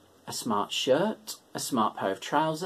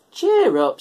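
higher, louder cheering; cheerful George; 8 semitones higher, 8 dB louder than usual. A narrator's reading. Giant cheering up the goat, higher and louder. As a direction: call out to lift the goat's spirits. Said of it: "said George".

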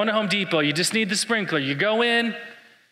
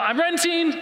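mock yell; 13 semitones higher than usual. Shopper calling out to the solar salesman, mock yell. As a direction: yell the excuse across the parking lot. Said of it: "or you yell out".

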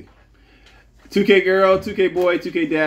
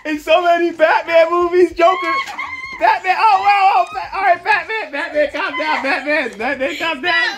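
excited shouting; 11 semitones higher than usual. Acted. Host calling the Batman and Joker fight, shouting excitedly. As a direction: yell it like a wild announcer breaking up a fight.

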